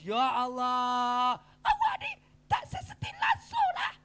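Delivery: hammed-up wail jumping to a very high squeal; mock wailing. Preacher mimicking forced crying for students, mock high-pitched wail. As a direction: draw out the cry, then squeak the complaint far up high. Mimics men made to cry.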